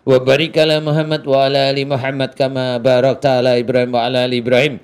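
chanted, steady prayer; prayer recitation. Real, not acted. Healer opening his prayer for Mbak Putri, chanted recitation. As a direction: intone the Arabic evenly, stretching phrase endings.

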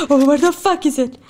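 normal talking voice

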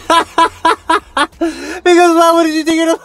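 giggling scorn at a cheap date; laughing mockery. Vlogger laughing at a guy taking his date to McDonald's, high and giggly. Mocking young guy's date.

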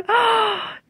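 high, sharp gasp of delight; amazed gasp. Real, not acted. Vlogger seeing her doll up close, amazed gasp. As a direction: gasp in sudden wonder.

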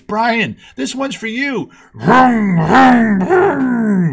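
triumphant dad. Retold or imitated. Dad on the radio dedicating the win to his son, triumphant. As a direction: show off the won car on air.

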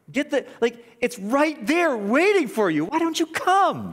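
high, insistent altar call; urgent appeal. Real, not acted. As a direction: plead with them to come, pitch climbing. Preacher pleading with listeners to come.

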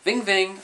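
high, buzzy mimicked phone ring; phone ring imitation. Vampire imitating his phone ringing, high ving ving. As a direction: sing the ring of an old phone. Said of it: "I show you that's not the ringtone".

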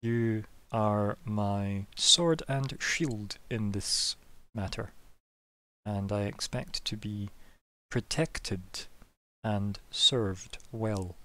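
slow, deliberate officer with long pauses; commander laying down expectations. Acted. Officer reinforcing orders to his sword and shield, slow and deliberate. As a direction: weigh out each phrase slowly, in command.

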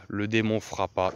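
plain reading voice

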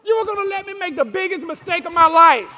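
loud, high mock indignation; mock outrage.

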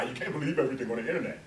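rattled off fast as a mock cliché; mocking the saying. Mocking the internet-doubters with a fast, parroted line. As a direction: toss off the cliché quickly, mocking it. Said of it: "let's not fall for that lie".

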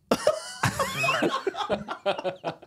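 big high burst of laughter. Real, not acted. Laughing at his own rating.

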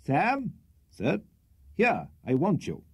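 calling across the ice, high; shouted. A narrator's reading. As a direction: call out to Sam across the bank. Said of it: "most innocently shouted".

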